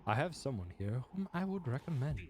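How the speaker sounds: polite minstrel. Acted.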